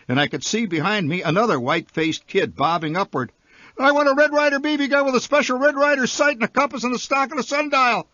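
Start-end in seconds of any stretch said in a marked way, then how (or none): 3.8-8.1 very high, fast, breathless wish list; blurted shout. A narrator's reading. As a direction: blurt it all out before the chance is gone. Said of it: "I shouted".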